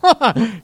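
quick burst of real laughter; short laugh. Real, not acted. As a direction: laugh it off briefly. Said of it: "I'm laughing".

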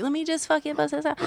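fast, soft cocky aside. Retold or imitated. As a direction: toss it off quickly. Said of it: "super confident".

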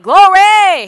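high, loud glory shout; ecstatic glory cry. Pretend. Pastor mimicking churchgoers cheering over a raise. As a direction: cheer it like good news. Mocking how believers react.

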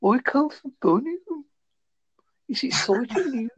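put-on lightly done Brummie accent; easygoing Birmingham accent impression. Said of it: "I can do a Birmingham".

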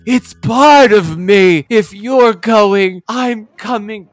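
high, very slow dramatic plea; Cheetara. Retold or imitated. Host quotes an ailing heroine insisting on coming, slow high plea. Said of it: "and she says".

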